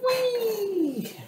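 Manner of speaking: high held squeal; a sudden high playful whee. Real, not acted. Playing with a pet, high squeal.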